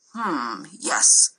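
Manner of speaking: low, drawn-out pondering hum; considering. A narrator's reading. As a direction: weigh it up slowly, deep in thought. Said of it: "obviously considering".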